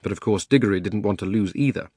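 ordinary delivery

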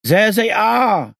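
very high, loud, slow shout. Acted. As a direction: yell it across the building. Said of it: "someone shouted".

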